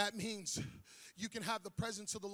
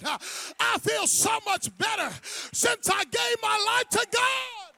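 loud, high, shouting new believer; zealous convert; 9 semitones higher, 12 dB louder than usual. Acted. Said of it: "and say".

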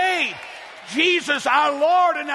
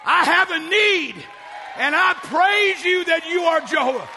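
with authority; 4 semitones higher than usual. Shown as a demonstration. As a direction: declare it to God with full authority. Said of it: "with authority".

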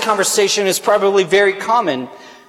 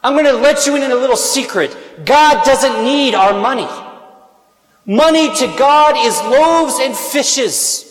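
full-force preaching; 5 semitones higher than usual. Acted. Priest challenging the parish to give, full-force preaching. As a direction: preach it out to the whole church.

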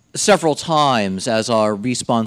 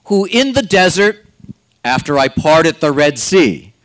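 building, emphatic divine rebuke; preaching at full force; 3 semitones higher, 4 dB louder than usual. Acted. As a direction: hammer the repeated phrase harder each time. Voicing God's rebuke, rising force.

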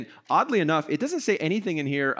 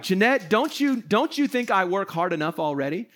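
mock-indignant complaint, pitched higher; offended husband; 5 semitones higher than usual. Husband acts out confronting his wife over the chore list, offended. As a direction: play the put-upon, offended husband. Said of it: "I could get offended".

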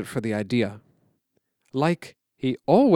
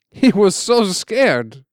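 put-on higher, louder old uncle; uncle's voice; 6 semitones higher, 6 dB louder, much slower than usual. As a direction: voice the uncle remembering.

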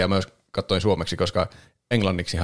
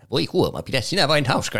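higher, lightly posh cat voice; fancy Karvinen voice; 6 semitones higher than usual. Retold or imitated. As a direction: imitate the snooty dubbed cat. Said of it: "miten mä voin imitoida sitä". (kevyesti matkittu hienosteleva Karvisen ääni)

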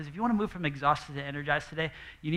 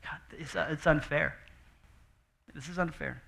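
soft, hushed, near-whispered lament; sad complaint; 3 semitones lower, 7 dB quieter than usual. Retold or imitated. Martha voiced as a sad, worn-out sister, hushed. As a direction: let it sink out of you in defeat. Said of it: "it's just sad".

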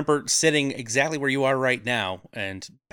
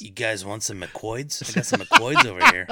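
sleazy dealer pitch rising to a squeal; shady dealer voice; 4 dB louder than usual. Acted. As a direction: hawk it like a shady street dealer. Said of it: "He sounds like a drug reject".

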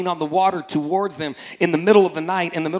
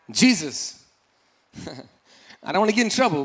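usual speaking voice

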